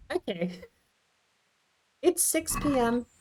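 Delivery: normal talking voice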